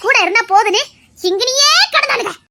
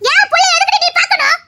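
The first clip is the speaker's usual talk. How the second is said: raised, high furious demand; angry shout; 6 semitones higher than usual. Acted. Mother shouting at the woman who came for her son.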